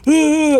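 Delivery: high, loud shriek imitating her fright; imitated startled scream. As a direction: mimic a jump-scare scream. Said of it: "and then was like".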